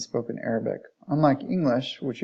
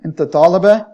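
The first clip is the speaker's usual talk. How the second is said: "Inta taliba" high and loud, climbing on taliba; rising question intonation; 5 semitones higher, 12 dB louder than usual. Shown as a demonstration. Teacher modelling a question for learners, rising intonation. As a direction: ask "Inta taliba" with a strong rise at the end. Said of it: "rising intonation indicating that you have a question".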